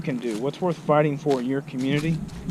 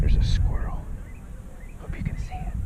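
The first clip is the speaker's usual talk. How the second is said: whispering; 4 dB quieter than usual. Real, not acted. Quietly pointing out a squirrel.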